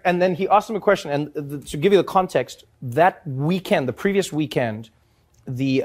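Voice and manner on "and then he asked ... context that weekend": usual speaking voice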